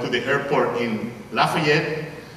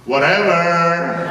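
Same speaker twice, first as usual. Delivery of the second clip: drawn-out whatever; 4 dB louder, much slower than usual. Acted. Drawn-out catchphrase.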